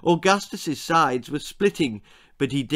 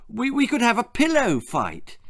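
much higher, eager outburst; boy carried away with excitement, higher; excited shout; 10 semitones higher than usual. A narrator's reading. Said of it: "shouted Augustus".

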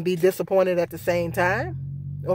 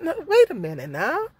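retold protest; 7 semitones higher than usual. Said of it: "I said".

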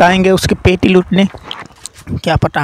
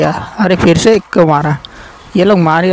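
ordinary talk

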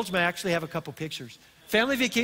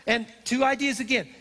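ordinary talk, nothing marked

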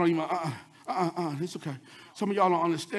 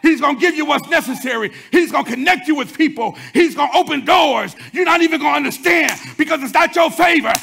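full-force preaching; 4 semitones higher, 6 dB louder than usual. Acted. Preacher lifting the church to a shout. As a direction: build the congregation up, hammering each phrase.

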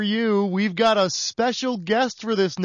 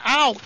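pained ow; 6 semitones higher, 4 dB louder than usual. Acted. Paper cut cry.

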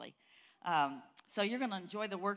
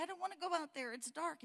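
put-on higher whiny little boy voice, softer; a small boy too scared to go outside; 8 semitones higher, 4 dB quieter than usual. Boy afraid of the dark in story.

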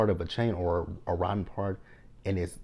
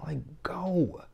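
mock whisper, high and dismissive; whispered shooing; 8 semitones higher than usual. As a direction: hiss her away under the breath. Telling Keely to leave.

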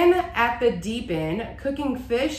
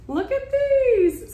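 excited squeal; 4 dB louder, slower than usual. Real, not acted. Showing off cute lights.

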